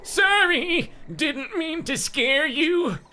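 put-on very high, slow silly old man; hammed-up squeaky old man. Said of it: "gonna do our old man voice".

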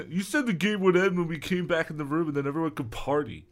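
high-pitched running complaint; hammed-up aggrieved kid; protesting. A narrator's reading. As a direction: protest in one indignant rush. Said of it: "Eric protested to Brendan".

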